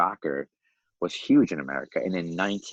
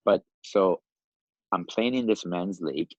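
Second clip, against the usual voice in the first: usual speaking voice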